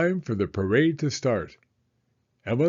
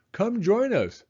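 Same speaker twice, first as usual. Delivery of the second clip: calling out; 9 semitones higher than usual. Acted. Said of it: "Emily Elizabeth shouted to them".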